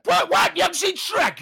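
high, loud disbelieving shout; a hammed-up, loud, high outburst at a friend who skipped Shrek; mock outrage. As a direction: play at being scandalised by a friend.

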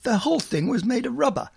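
put-on high-pitched comic drunk old lawyer; Roly Birkin. Imitating a drunk TV character mid-anecdote, high comic blurt. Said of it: "he'll suddenly say".

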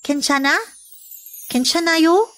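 high, gentle rising Kenchana?; concerned asking. Shown as a demonstration. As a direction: ask with compassion if they're alright. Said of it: "a word of compassion when asked this way".

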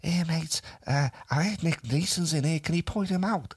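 a hint of a Scouse bloke; Scouse accent. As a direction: imitate the Liverpool lad asking. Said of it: "Scouser leaned into me and said".

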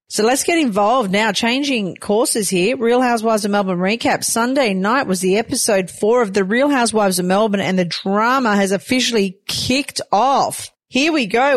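host launching into the Real Housewives recap; upbeat show host. Acted. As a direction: kick off the recap segment with energy.

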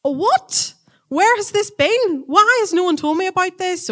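high-pitched mock disbelief; acted bewilderment. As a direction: voice a baffled listener, pitched well up. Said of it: "you're going".

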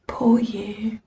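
pitying little whisper; mock sympathetic whisper. Audience whispering pity at the failing medium. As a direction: hush it like an awkward onlooker. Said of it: "sympathetic smile thing".

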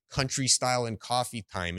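ordinary delivery

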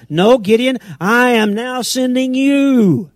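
loud, high, drawn-out declaration; forceful divine command, stretched out; commanding Lord. Acted. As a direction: proclaim it as God overruling Gideon. Acting the Lord answering Gideon.

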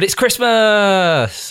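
high, loud drawn-out holiday cheer; festive cheer. Real, not acted. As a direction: belt it out, stretching Christmas. Festive announcement on the show.

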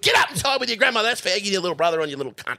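loud, high, rapid scolding; telling-off grandparent. Retold or imitated. Grandparent ordering the kid about, mock-angry rapid yelling. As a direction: imitate a grandparent tearing into a kid.

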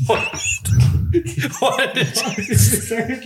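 spluttering laugh; bursting out laughing, high; spit take. Real, not acted. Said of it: "[name] just spat all over my fucking arm".

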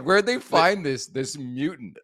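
high, loud question through laughter; laughing mock disbelief. Host ribbing his co-host about his arrival, laughing mock disbelief. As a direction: tease it out slowly, cracking up.

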